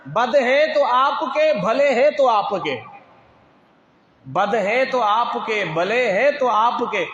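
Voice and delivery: slow, rhythmic couplets rising high; measured Urdu poetry recital from the pulpit; devotional verse recitation. Acted. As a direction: declaim the poem with drawn-out words.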